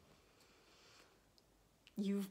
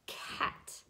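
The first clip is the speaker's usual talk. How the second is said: soft whisper; 10 dB quieter than usual. Shown as a demonstration. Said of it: "let's say those sounds together".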